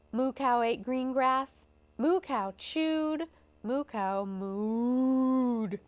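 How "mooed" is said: very slow, long "mooed"; long lowing vowel; mooing word. A narrator's reading. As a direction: stretch the word into a cow's moo. Word imitates cow's moo.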